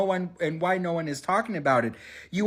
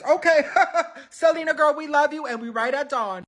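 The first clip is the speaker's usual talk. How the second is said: laughing cheer; 10 semitones higher, 3 dB louder than usual. Real, not acted.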